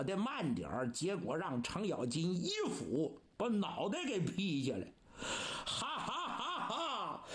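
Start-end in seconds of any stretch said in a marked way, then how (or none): 5.6-7.2 high, evenly spaced ha-ha bursts; triumphant stage laugh. Acted. Cheng Yaojin after felling his foe, high triumphant laugh. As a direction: crow with a high mock laugh.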